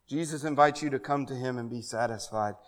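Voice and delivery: ordinary talk, nothing marked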